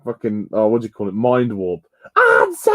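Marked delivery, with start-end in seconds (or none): 2.1-2.7 very high, drawn-out villain screech; Sil's shouting. Retold or imitated. Recalling Sil's voice in Mind Warp, high mock shout. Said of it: "All the fucking shouting".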